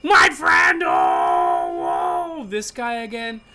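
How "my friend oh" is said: booming spirit voice. Acted. Ghost appears in vision.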